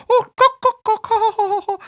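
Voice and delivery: loud falsetto clown clucking and hooting; high-pitched clown voice. Retold or imitated. Buffoon doing silly jokes for the audience, high-pitched voice. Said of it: "he's got this high-pitched voice".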